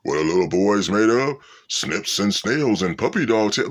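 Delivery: commercial rhyme voice. Retold or imitated. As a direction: lightly chant the nursery rhyme like the ad. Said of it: "that damn shit where he used to say".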